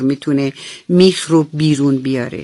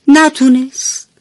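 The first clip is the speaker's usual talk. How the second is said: high, slow, let-down voice; disappointed; 9 semitones higher, 5 dB louder than usual. A narrator's reading. Narrator telling kids the screwdriver failed, let down. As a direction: sigh out the failure. Screwdriver fails to pull nail.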